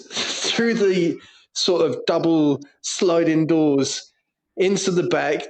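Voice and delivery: ordinary delivery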